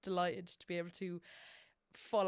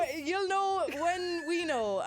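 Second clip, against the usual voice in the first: dramatic; 10 semitones higher, 6 dB louder, slower than usual. Acted. Dramatic exaggerated delivery.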